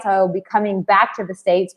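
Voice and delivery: ordinary talk, nothing marked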